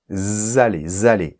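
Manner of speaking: zallez, with a strong buzzing z at the start; voiced z liaison. Shown as a demonstration. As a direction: lean on the z of zallez. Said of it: "un S sonore".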